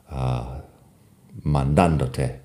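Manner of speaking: normal talking voice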